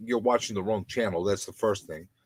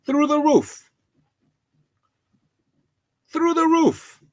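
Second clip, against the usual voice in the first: high, loud outburst of disbelief; pitched-up indignant cry; exasperated shout; 6 dB louder, slower than usual. Real, not acted. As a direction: yell it up at the ceiling in outrage. Ranting about bills going up.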